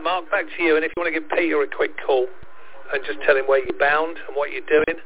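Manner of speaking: ordinary talk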